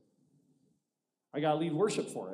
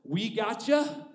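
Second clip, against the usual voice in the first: louder, higher, breezy mock promise; slow, higher mock reassurance; mock pastors' promises; 8 semitones higher, 8 dB louder, slower than usual. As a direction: imitate eager pastors brushing you off. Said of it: "Every one of them said".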